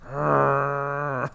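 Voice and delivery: long held "argh" of frustration; frustrated growl. Retold or imitated. Showing how he felt by the chairs, frustrated growl. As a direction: growl it out, drawn out on one note.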